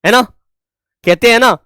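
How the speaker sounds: ordinary delivery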